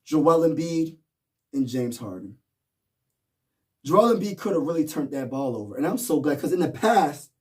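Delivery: ordinary talk, nothing marked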